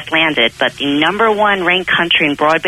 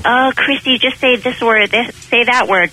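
higher, mock-eager voice of teasing classmates; 7 semitones higher than usual. College friends coax her to show her accent, mock-eager teasing. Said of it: "everyone was like".

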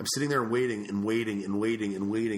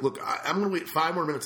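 raised, exasperated ultimatum; fed-up threat; 6 semitones higher than usual. Retold or imitated. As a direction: warn him you'll walk out. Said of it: "I got so pissed".

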